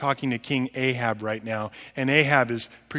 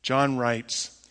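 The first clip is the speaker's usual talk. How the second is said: usual speaking voice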